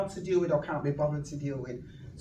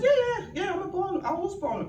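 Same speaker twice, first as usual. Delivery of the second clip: eager agreeing. Retold or imitated. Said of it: "I said".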